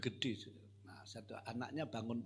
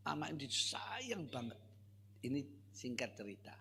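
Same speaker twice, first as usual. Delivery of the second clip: ordinary talk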